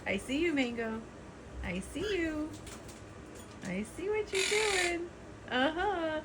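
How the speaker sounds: high sing-song bird's voice; voicing the bird. Owner voicing one parrot watching the other, high sing-song. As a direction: speak for the bird, teasing. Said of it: "She's like".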